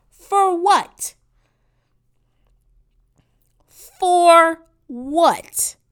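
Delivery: loud, high, drawn-out demand; shouting an outraged question; exasperated shout. Real, not acted. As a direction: yell it in disbelief. Exasperated rant about Snapchat.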